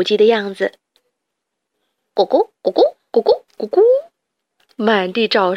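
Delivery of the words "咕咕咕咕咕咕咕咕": high silly hen clucks; mock chicken clucking. Rats act like hens.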